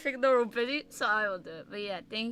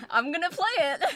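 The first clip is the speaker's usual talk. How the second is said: laughing; 6 semitones higher, 7 dB louder than usual. Real, not acted. Laughing emphatic repeat.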